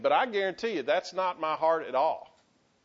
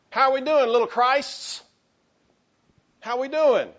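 rallying call; 8 semitones higher, 6 dB louder than usual. Real, not acted. Rallying the congregation.

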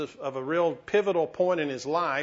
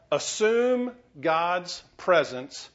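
very slow, each word weighted; slow emphatic; much slower than usual. Shown as a demonstration. Preacher giving his flock the first point, slow and deliberate. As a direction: spell out assume God's presence slowly. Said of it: "The first thing is".

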